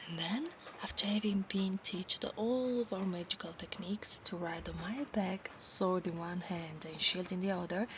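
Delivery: soft whispered storytelling; hushed whisper. Acted. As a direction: whisper the promise close to his ear. Whispered roleplay.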